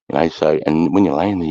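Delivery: normal talking voice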